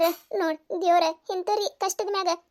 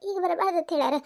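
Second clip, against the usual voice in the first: usual speaking voice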